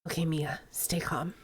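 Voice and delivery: very soft whisper to herself; hushed self-reassurance; inner whisper. Acted. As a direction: barely breathe it to calm down. Inner thought calming herself.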